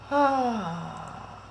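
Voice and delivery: long sigh trailing off. Real, not acted. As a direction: let out a drawn-out sigh. Host stuck on audio trouble.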